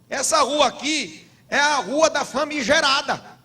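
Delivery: mais alto e agudo, pregando com força; pregação inflamada. Acted. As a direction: adverte a congregação com fervor. Prega citando Provérbios. (pastor advertindo a igreja em pregação inflamada, mais alta e aguda)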